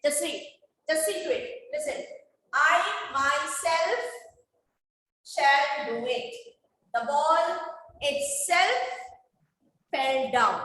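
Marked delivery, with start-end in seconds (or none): 2.5-6.3 slow, measured reading with "myself" drawn out firmly; stress on "myself". Shown as a demonstration. Teacher shows students how emphatic pronouns are read, stress on "myself". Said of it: "The reading was lay stressing on". 6.9-10.6 "itself" pitched up and set apart; stress on "itself". Shown as a demonstration. Teacher reads an example for students to hear, stress on "itself". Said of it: "The reading was lay stressing on".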